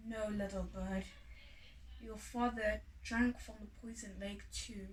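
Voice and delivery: mother bird softly telling bad news; quiet sad mother. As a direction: break the hard news gently.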